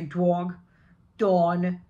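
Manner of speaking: Boston-style dog and dawn, jaw dropped way back; New York accent. Shown as a demonstration. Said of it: "go into a New York accent or Southie".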